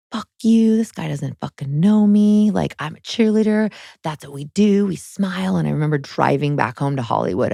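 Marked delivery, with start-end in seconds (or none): 0.1-5.5 retold rage. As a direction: spit it back at the coach, pitched up. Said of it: "I remember just being enraged".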